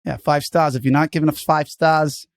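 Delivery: punchy mock Boston accent; hammed-up Boston tough guy. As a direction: berate them in a put-on Boston accent. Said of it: "keep this energy all the way".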